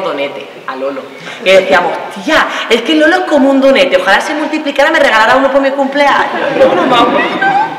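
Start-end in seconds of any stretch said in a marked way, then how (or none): 2.1-7.8 high-pitched girlish gushing, imitated; hammed-up swooning excitement. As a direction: gush to a friend, rising into a squeal. Gushing about boyfriend to friend.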